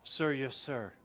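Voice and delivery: quiet. Acted. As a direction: mumble the military reply. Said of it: "tone it down with the".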